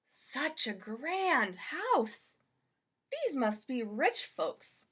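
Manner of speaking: slow, wondering maid voice; Amelia Bedelia. Acted. Maid alone in a grand house, slow and wondering. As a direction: marvel at the house, then bustle.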